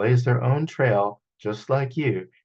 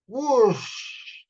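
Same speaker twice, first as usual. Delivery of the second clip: whoosh sound; 11 semitones higher than usual. Acted. Storyteller makes the whoosh of Dom taking off. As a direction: sweep up like taking off. Lifting off into flight.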